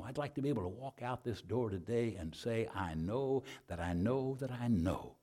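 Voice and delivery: soft, low invitation to pray; altar call. Real, not acted. Preacher asking seekers to lift a hand, soft altar call.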